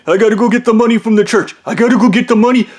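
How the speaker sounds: frantic high mock gabble; panicked babble. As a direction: rush it out in a panic. Panicked Kevin repeats himself.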